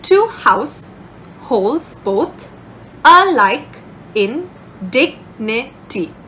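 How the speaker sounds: Two house, holds both, alike in, dignity, each foot split off by a pause; teacher dividing the line into its five feet with pauses between them; divided by feet. Shown as a demonstration. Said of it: "let's try to divide it".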